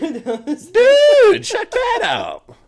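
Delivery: high, loud, drawn-out excitement; real amazement. Real, not acted. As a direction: blurt it out high and stretch it. Amazed at being shown Power Rangers.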